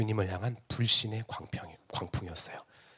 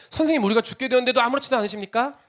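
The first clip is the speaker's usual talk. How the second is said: panicked plea; 11 semitones higher, 5 dB louder, faster than usual. Acted. Disciples wake Jesus in storm.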